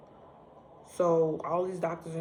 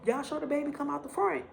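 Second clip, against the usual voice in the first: high, loud cry of alarm; pained shock; 8 semitones higher, 3 dB louder than usual. Retold or imitated. Woman in labour startled by pain in the wrong place, high alarmed cry. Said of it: "I was like".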